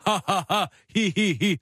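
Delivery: dry imitation of forced ha-ha, hee-hee; mock laughing. Mocking a clown in the middle of the circle, dry fake laugh. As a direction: parody a laughter coach with evenly spaced syllables. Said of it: "som står og griner i midten".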